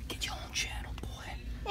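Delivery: unvoiced playful whisper. Real, not acted. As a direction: whisper a joke aside. Joking whisper to son.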